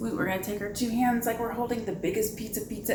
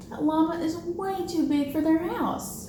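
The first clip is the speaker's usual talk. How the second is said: playful storytime; 8 semitones higher than usual. Acted. Reading picture book to kids.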